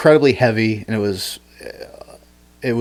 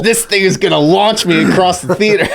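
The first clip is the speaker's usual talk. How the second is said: excited; 8 semitones higher, 4 dB louder than usual. Retold or imitated. Retelling his fear of a seat launching.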